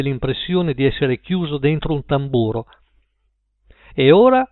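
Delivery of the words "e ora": much higher and louder announcer opening; booming radio voice. A narrator's reading. Radio host booming to his listeners. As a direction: boom it out over the airwaves. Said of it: "tuonò la radio".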